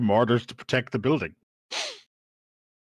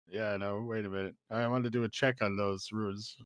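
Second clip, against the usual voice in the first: ordinary delivery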